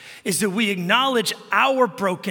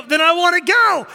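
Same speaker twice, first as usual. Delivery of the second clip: put-on high, loud kid declaration; hammed-up stubborn child; insistent child imitation; 9 semitones higher, 8 dB louder than usual. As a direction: belt it out like a determined kid. Son's insistent repeated answer.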